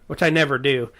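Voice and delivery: usual speaking voice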